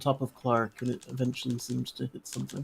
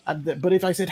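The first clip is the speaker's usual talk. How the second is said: usual speaking voice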